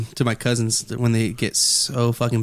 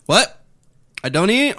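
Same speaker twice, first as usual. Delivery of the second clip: surprised; 6 semitones higher than usual. Retold or imitated. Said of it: "I'm like".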